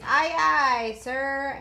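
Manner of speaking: crisp sailor answering an order; sailor's aye aye. A narrator's reading. Hab mimics a sailor obeying his captain. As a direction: snap it off like a deckhand. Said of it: "when a man's on shipboard".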